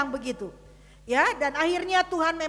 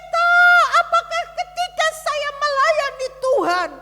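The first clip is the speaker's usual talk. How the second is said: loud, high cry to the pastor; retold outcry; 10 semitones higher, 7 dB louder than usual. Friend crying out to his pastor about God, loud and high. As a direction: cry out the question at the top of the voice. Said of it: "Dia berteriak kepada saya".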